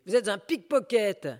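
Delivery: high, loud retort; angry accusation. Acted. Woman shouting back at the thug, angry. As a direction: fling the accusation back at him. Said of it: "La prostituée".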